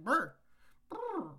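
loud, high shivery brr; shivering brr. Retold or imitated. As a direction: shiver out the cold. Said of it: "I'm like".

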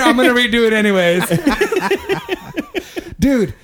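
laughing through the words; cracking up mid-sentence. Real, not acted. Laughing while retelling.

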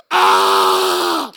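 very loud, hammed-up crying scream; imitated toddler's wail. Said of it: "he just starts crying".

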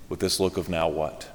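very low, soft stunned question; bewildered. Retold or imitated. The diner crowd staring after Agnes, softly bewildered. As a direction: let it hang, baffled.

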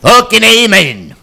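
slow, loud mock amen; preacher voice. Mock church call and response.

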